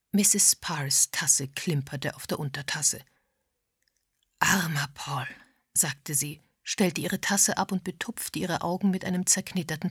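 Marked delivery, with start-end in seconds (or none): 4.4-5.3 low-key, breathy grief; grieving. A narrator's reading. A tearful widow-like mourner pitying dead Paul, grieving. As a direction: say it sorrowfully, half under the breath. Mourning, dabbing her eyes.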